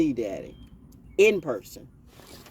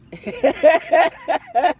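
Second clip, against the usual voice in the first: high, loud put-on laugh; imitated laughter; 11 semitones higher, 7 dB louder than usual. Imitating the father's laugh before a fight, high and loud. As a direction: cackle like the laughing daddy. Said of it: "she go into laughter".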